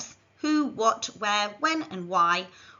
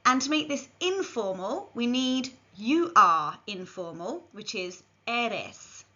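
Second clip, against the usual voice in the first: ordinary delivery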